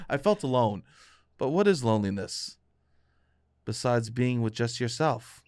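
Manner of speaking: low, soft musing with heavy pauses; mock-poetic melancholy. Study-abroad returnee coming onto the podcast, mock-poetic melancholy. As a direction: deliver it as pretentious confessional poetry.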